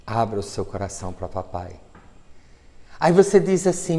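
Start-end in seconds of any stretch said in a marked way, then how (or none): 0.1-1.7 low soft repeat of the invitation; tender plea. Acted. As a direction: coax gently, almost whispered.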